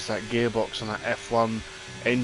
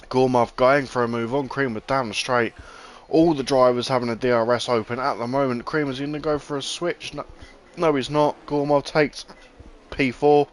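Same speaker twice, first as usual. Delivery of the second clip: excited; 2 semitones higher, 6 dB louder than usual. Real, not acted. Live overtake down the straight.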